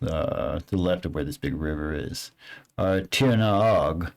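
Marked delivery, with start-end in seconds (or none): none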